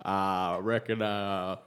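slow Western drawl; cowboy voice. Pretend. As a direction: talk like an old cowboy. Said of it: "It's just like what a cowboy would say".

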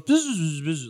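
high chattering bee buzz. Acted. Game master as bee answering its rider, buzzing. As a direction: buzz in short bursts.

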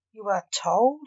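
doubting, pointed question; suspicious. A narrator's reading. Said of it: "said Simon suspiciously".

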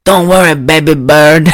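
hammed-up bad Paraguayan accent. Snake hunter reassuring Denise, in a bad Paraguayan accent. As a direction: imitate an awful fake Paraguayan accent. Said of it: "He's so awful at this accent".

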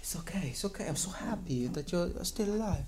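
soothing Guardiola. Retold or imitated. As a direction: coo it like a relieved manager. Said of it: "I bet Guardiola was like".

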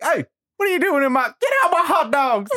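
high-pitched, fast pretend holler; mock indignant yell. Imagining catching a bear in one's things, mock indignant yell. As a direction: shout it as if catching a thief. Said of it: "And I would sound like that".

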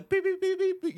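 high-pitched beeps covering a swear; rapid high mimicked bleeps; imitated censor beep. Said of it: "swearing at me like".

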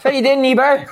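mock Aberdeen terrace shout; Aberdeen accent. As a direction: heckle in a put-on Aberdeen accent. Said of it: "shouts you'd hear in that accent".

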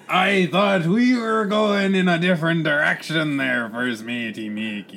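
high, loud, stretched pirate cry; bellowing pirate captain. Acted. Captain demands news of first mate, bellowing pirate. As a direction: bellow it across the cabin, drawn out.

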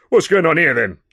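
loud, high constable's demand; policeman calling out. Acted. Older constable demanding what is happening, calling out. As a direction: demand an answer, loudly. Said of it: "cried the older of the two officers".

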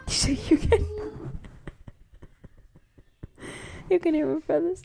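can't get the words out for laughing; cracking up while pointing out her brothers. Real, not acted.

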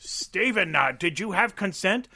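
high, loud mock interviewer; mock talk show host. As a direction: grill Steven like a cheesy host. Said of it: "mock Alex Jones interview".

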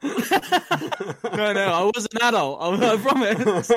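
high, loud laughing denial; mock-defensive giggling denial; laughing protest. Real, not acted. Jokey protest against accusation.

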